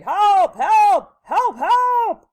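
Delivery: high, very loud cries for help; urgent separate bursts; screaming. A narrator's reading. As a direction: scream like a panicked storybook policeman. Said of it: "A policeman was screaming".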